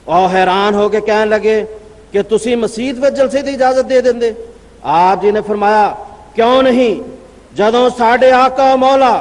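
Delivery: normal talking voice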